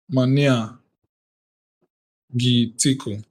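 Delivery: normal talking voice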